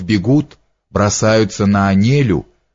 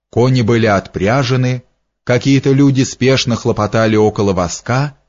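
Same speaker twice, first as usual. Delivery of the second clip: usual speaking voice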